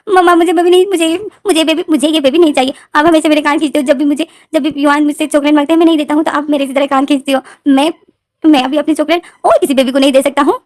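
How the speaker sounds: put-on high, loud tearful whining boy; crying child. Little boy crying to mama about chocolate and ear pulls. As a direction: wail it like a hurt kid. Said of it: "रोते हुए बोलते".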